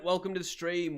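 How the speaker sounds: upbeat, high welcome; bright greeting. Real, not acted. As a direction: greet the new viewer cheerfully. Greeting viewer Grant.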